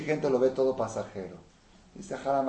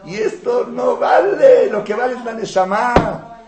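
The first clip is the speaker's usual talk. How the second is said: loud, much higher, slow; preaching shout; 12 semitones higher, 15 dB louder, slower than usual. Acted. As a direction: thunder out the point. Preaching climax.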